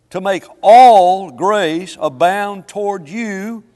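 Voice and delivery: loud, slow, swelling preacher's cry; preaching at full force. Acted. Preacher reading scripture to his congregation, loud and drawn out. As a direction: lean into every word like a pulpit climax.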